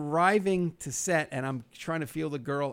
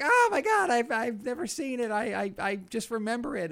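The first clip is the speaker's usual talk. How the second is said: put-on much higher girly voice, fading down; high mock gush as Stella; imitating Stella; 8 semitones higher than usual. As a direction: mimic her high excited gush. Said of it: "she's like".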